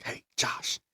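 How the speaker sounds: breathy whisper to his brother; hushed call. Retold or imitated. Boy whispering to his brother in the kitchen, hushed. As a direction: whisper it so only Josh hears.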